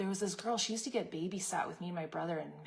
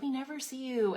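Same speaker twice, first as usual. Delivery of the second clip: high mock guilt-trip whine from a nagging aunt; 7 semitones higher than usual. Aunt guilting her niece for skipping family events, mock whine. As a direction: play the aunt guilt-tripping you, pitched up.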